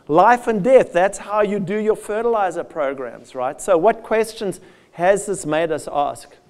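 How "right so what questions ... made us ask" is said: ordinary talk, nothing marked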